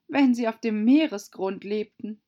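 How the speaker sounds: put-on high, loud girlish voice; young Alice objecting. (hohe, laute Alice-Stimme)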